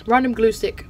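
ordinary talk

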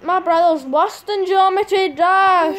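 slow, loud wail of protest; loud complaint. Real, not acted. Player complaining about brother during a game, loud. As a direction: announce the grievance at full volume.

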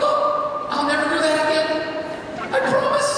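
high, loud, pleading mock whine; hammed-up scared promise, voice climbing; mock panicked rider. Instructor mocks a frightened rider begging, high mock panic. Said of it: "everybody goes".

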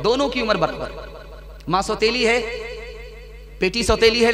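ordinary delivery

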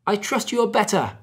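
high-pitched, eager call up to a friend; cried out. A narrator's reading. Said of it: "he cried".

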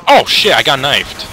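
loud, high outcry; shocked shout. Real, not acted. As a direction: yell out at being caught by surprise. Killed by surprise knife.

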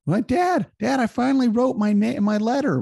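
put-on high, eager child voice; proud toddler announcing news; little kid voice.

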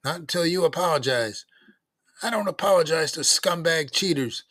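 put-on loud, high, defiant kid voices; angry quarrel. A narrator's reading. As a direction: hurl it back mid-fight, loud and high. Acted fight between two boys.

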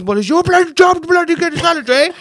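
put-on father on the phone blaming his son in an Indian accent; Indian accent dad. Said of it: "he gets more and more Indian".

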